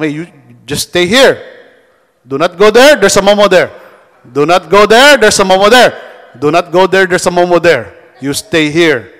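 loud mock scolding uncle; babysitting uncle. Uncle on the couch warning the kid, loud mock scolding. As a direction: bark orders at the kid from the couch. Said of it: "they just tell them".

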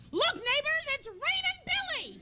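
high, hammed-up yell to the neighbors; mock yell. Pushing Billy off the roof, a high hammed-up yell. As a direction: call it out like a gleeful prank. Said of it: "and then yell".

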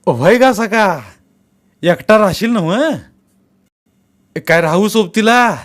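caring elder's concerned questions; kindly old man asking. Acted.

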